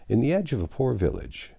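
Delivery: ordinary delivery, nothing marked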